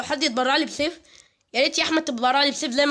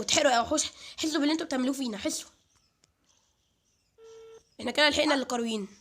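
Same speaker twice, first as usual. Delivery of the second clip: ordinary talk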